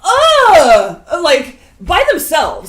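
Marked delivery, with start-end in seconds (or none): 0.0-1.0 disgusted groan. Real, not acted. Disgusted at the camera footage.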